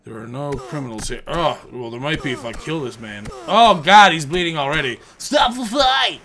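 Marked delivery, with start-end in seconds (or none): 5.2-6.2 slow, higher shouted call; mock referee shout. Player playing referee to the bleeding boxer, mock shout. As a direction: call it like a ringside official.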